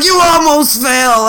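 slow and high through laughter, retelling her giggle; laughing. Retold or imitated. Coworker on the floor laughing at him, high slow giggle. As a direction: imitate her laughing as she says it.

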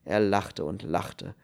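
ordinary delivery, nothing marked

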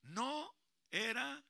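loud slow line for echo; slow prompt. Shown as a demonstration. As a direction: dictate slowly for him to repeat. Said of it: "Diga, mijo". (dictado lento y fuerte)

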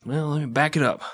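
ordinary talk, nothing marked

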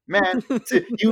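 lightly hammed-up interviewer; mock reporter voice.

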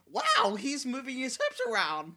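high, slow amazed gasp. Retold or imitated. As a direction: marvel at it, drawn out. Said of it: "I was just like".

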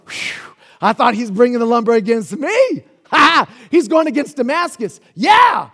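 loud, high mock relief with a laugh; relieved gloating shout. Israel and Judah relieved judgment hits Damascus, gloating shout. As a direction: crow with relief that it isn't you. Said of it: "Israel and Judah were like".

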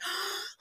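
sharp gasp. Retold or imitated. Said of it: "I gasped".

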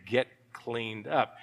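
low, soft and slow; quiet pointed command. Acted.

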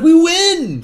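high, loud imitated celebration; mock victory cheer. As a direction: shout it as the triumphant heroes.

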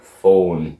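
very low, flat-dead single word; a deep menacing boss demanding it; cold boss. Retold or imitated.

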